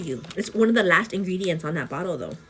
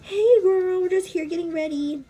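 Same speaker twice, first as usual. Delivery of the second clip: high, loud cooing greeting; 11 semitones higher, 4 dB louder than usual. Real, not acted. Mother calling hello to her baby, high and bright.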